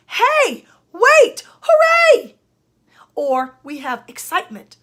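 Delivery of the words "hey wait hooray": loud, high, separate bursts; exclaimed interjections. Shown as a demonstration. Teacher demonstrating interjections for a student, exclaiming loudly. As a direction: call each word out with an exclamation mark. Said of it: "we have something called interjections".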